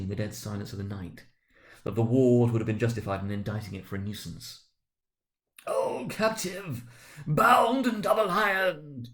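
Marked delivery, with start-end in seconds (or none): 5.7-9.1 much higher, slow, louder wail; phantom's cry. Acted. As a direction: cry out like a chained spirit in anguish. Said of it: "cried the phantom".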